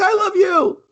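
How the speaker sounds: squeaky hammed-up "I love you"; mock-tender high. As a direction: squeak back the confession. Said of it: "She's like".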